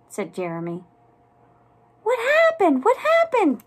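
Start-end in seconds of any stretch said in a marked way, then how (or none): 2.0-3.7 very high, eager little mouse voices; high-pitched excited mouseling chorus; mouselings crying out. A narrator's reading. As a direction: squeal it, desperate to hear more. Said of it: "cried all the mouselings".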